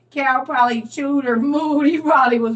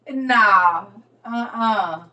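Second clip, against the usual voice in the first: ordinary talk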